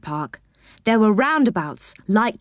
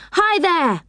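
controlled storybook yell, pitched up; 9 semitones higher than usual. A narrator's reading. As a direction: call out to the driver. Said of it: "yelled Joe to the driver".

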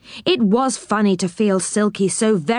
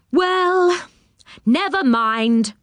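high drawn-out start, easing down; cheering up; 7 semitones higher, much slower than usual. A narrator's reading. Moonface after finding the can empty, cheering up. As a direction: shrug off the setback, a touch brighter. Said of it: "cheering up".

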